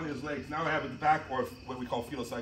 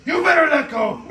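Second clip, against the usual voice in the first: high, loud warning yelled through laughter; laughing mock shout; 13 semitones higher, 16 dB louder, slower than usual. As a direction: bark the warning while cracking up. Playful threat to training partner.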